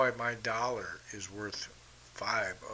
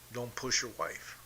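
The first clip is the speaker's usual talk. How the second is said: ordinary talk, nothing marked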